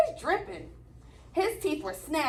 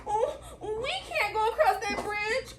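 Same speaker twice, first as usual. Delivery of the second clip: high, worried goat plea; mild fearful cry; 7 semitones higher than usual. Acted. Middle goat pleading with brothers, high and fearful. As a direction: fret about the troll. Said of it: "cried Middle Billy Goat Gruff".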